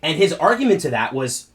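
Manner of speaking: ordinary delivery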